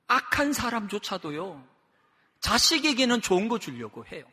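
ordinary delivery

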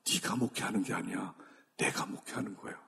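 soft, low voice of God; God's correction. Retold or imitated. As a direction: correct gently, dropping to near whisper. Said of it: "그러시더라고요".

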